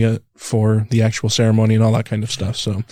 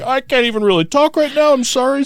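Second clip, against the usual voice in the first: pitched well higher, a hint of tight throat; choked up; 12 semitones higher than usual. Groom retells proposing on one knee, lightly choked up. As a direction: recall the words as if tears are coming. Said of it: "I get all choked up".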